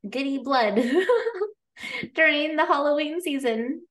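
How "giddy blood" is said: ordinary talk, nothing marked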